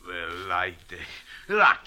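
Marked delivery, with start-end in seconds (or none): 1.5-1.9 sudden yelp. Acted. Nervous club owner blurts out.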